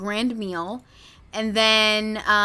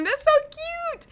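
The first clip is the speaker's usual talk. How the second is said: cutesy gush; slower than usual. Real, not acted. Gushing over photos of celebrities.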